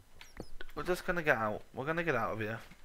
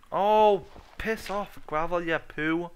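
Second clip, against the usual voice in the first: annoyed at gravel; 5 semitones higher, 7 dB louder, slower than usual. Real, not acted. Cursing the gravel.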